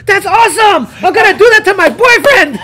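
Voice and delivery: put-on high, laughing and loud, thrilled girl; a girl gushing she'll choke her boyfriend; giddy retold glee. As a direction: squeal it like she loves the idea.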